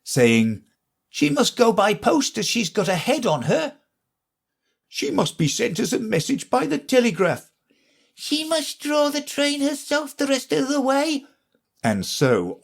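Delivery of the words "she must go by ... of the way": other passengers. A narrator's reading. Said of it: "other voices went on".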